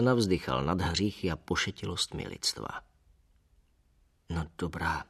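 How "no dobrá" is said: audible reluctance. A narrator's reading. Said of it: "řekl nakonec se slyšitelným přemáháním".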